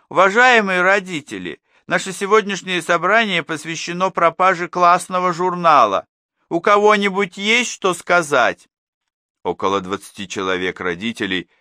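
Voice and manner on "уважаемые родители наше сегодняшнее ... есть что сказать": higher, louder official teacher voice; formal class teacher. A narrator's reading. Class teacher addresses the parents about the lost register, formal.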